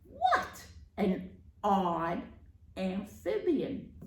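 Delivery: ordinary delivery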